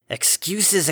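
"Excuses" thrown out high and sharp. Acted. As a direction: snap "Excuses" up high in scorn. Father dismisses her excuse.

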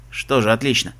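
low, gruff captain; grumbled. A narrator's reading. Captain accepts that everyone is ready, grumbling. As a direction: mutter acceptance at a low pitch. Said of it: "буркнул капитан".

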